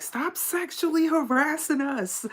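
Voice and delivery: squeaky high mock scolding. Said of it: "that's why y'all heard me get like that".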